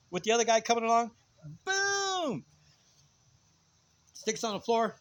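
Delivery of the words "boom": long, high-pitched "boom" for a blow landing; booming hit. Retold or imitated. Storyteller acting out a stick fight, booming hit. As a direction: draw out the impact sound high.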